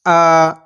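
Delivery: अ sounded alone, short and clean, pitch dropping; a lone, bare अ held on one pure sound; pure vowel demo. Shown as a demonstration. Teacher to students showing the pure vowel, a bare clean अ. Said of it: "इसको अदा करने की कोशिश करते हैं".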